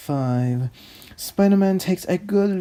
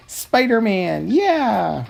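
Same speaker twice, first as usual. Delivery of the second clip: loud, high hero cheer; 7 semitones higher, 5 dB louder than usual. Acted. Narrator hyping the hero's arrival, loud cheer.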